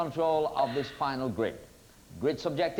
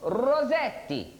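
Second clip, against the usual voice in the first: slow, higher, hammed-up Italian accent; mock Italian accent; 5 semitones higher, slower than usual. Host echoes contestant's answer, drawn-out mock Italian accent. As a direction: draw the name out in mock Italian. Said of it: "in your best Italian".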